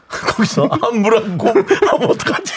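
words broken by laughter; laughing. Real, not acted. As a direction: laugh through it. Both laughing.

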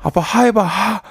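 put-on high little kid demanding; child voice. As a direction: chirp it like a small child. Child tells dad to breathe out.

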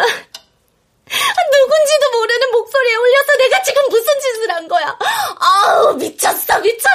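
high, strained distressed voice; panicked self-reproach. Acted. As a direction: near tears, scolding herself. Panics at what she did.

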